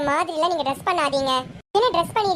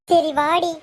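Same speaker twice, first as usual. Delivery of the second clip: normal talking voice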